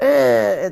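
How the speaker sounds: loud scornful "ugh"; disgusted grunt. Shown as a demonstration. Showing sisters the sour look they give, disgusted grunt. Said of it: "like this".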